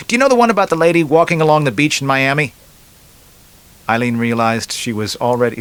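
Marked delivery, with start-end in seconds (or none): 0.1-2.5 higher, louder, quick joke setup; joker starting a joke. Acted. Man at a bar telling a woman a joke, eager joker.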